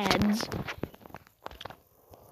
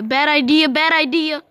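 louder and higher, alarmed; panicked yelling; 4 semitones higher, 7 dB louder than usual. Real, not acted. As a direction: yell it as the crash comes. Panics before hitting car.

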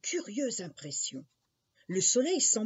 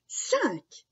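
put-on high child's exclamation; triumphant guess; 10 semitones higher, 4 dB louder than usual. Jacques answers the old man's riddle, high and excited. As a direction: pipe up with the answer. Boy calls out his answer.

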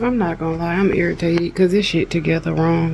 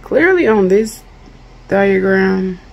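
louder, pressing each word; slow and louder, fed up; frustrated emphasis; 2 semitones higher, 7 dB louder, slower than usual. Real, not acted. Jabbing at the confusing diagram, louder and frustrated. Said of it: "I'm just very irritated".

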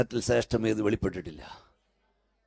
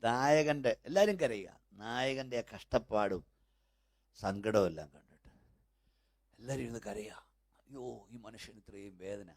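softer, hushed telling of the tale; quiet storytelling; 5 dB quieter than usual. Acted. Preacher softly tells the theatre parable. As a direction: draw them in with a soft story.

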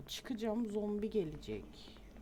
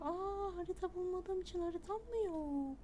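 surprised; 11 semitones higher than usual. Real, not acted. Realises the map is missing.

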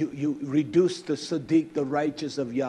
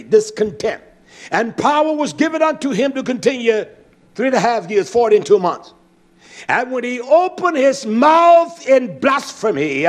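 full-force preaching; 5 semitones higher, 8 dB louder than usual. Acted.

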